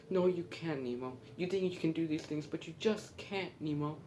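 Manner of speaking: high, insistent scolding father; scolding dad. Retold or imitated. Marlin scolds Nemo in front of the class, high insistent scolding.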